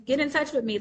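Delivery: usual speaking voice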